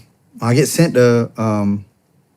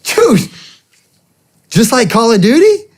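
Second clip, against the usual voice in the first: much higher, thrilled incredulous question; excited disbelief; 10 semitones higher, 3 dB louder than usual. Retold or imitated. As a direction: echo it back, amazed and eager. Incredulous echo of recruiter's pitch.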